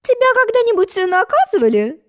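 a hint of a girl's voice; lightly voiced girl Alice. A narrator's reading. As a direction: play young Alice asking, lightly. High-voiced Alice character.

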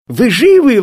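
put-on children exclaiming in astonishment; amazed exclamation. A narrator's reading. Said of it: "воскликнули они вместе". (изумлённое восклицание)

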